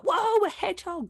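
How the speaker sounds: high, delighted whoa; amazed whoa. Retold or imitated. Guest mimics her own amazement at a hedgehog, high and delighted. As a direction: gasp like she's never seen one. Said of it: "even now I'm like".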